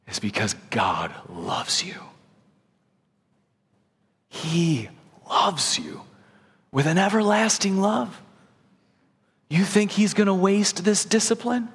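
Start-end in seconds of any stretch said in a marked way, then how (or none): 0.1-6.0 hushed, slow whisper; a tender secret, whispered to the flock. Real, not acted. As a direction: drop to a whisper to land it. Whispered emphasis in sermon.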